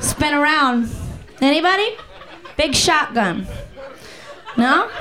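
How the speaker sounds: ordinary talk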